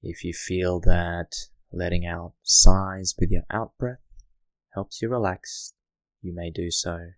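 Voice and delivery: hushed guiding voice for settling in; guided meditation. Acted. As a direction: ease the listener into stillness, gently.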